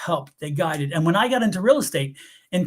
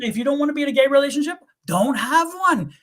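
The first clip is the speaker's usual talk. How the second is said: don't get one, punched high; pitched-up insistence; emphatic; 6 semitones higher than usual. Real, not acted. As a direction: hammer the point home. Continues emphatic point.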